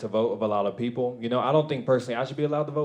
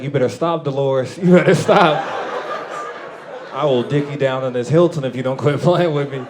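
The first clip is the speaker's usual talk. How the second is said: louder, laughing mock threat; mock warning; 5 dB louder than usual. Comic mock-threatening old lady Dolores, loud playful warning. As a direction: warn her off with playful bravado.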